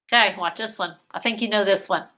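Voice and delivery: ordinary delivery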